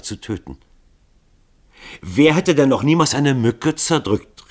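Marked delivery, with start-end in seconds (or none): 2.0-4.5 rufend. Acted. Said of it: "rief Starkington".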